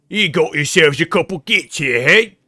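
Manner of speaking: hammed-up orc war talk; British orc. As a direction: bellow it slowly like a Cockney orc. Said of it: "You guys know my opinion on orcs".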